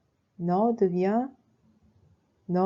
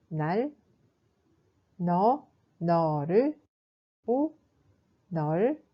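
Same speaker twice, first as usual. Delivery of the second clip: ordinary delivery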